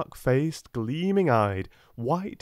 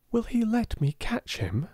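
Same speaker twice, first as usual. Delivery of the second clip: a lightly voiced girl, asking much higher; gentle question; 12 semitones higher than usual. A narrator's reading. Sarah asking the servant about his monkey. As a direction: ask kindly, rising. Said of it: "she asked".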